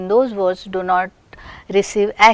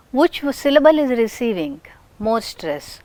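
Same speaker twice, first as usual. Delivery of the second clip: usual speaking voice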